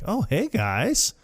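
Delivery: high, slow imitated hello; retold hello, high and stretched; mock casual greeting. Voices Bob's surprise greeting.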